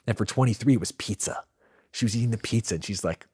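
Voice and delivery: normal talking voice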